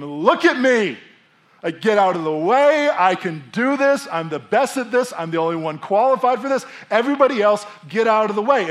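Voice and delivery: loud, high commanding shout; scream. Pretend. Pilot shouting to the passengers to clear the way. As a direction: take charge of the plane. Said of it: "get up and scream".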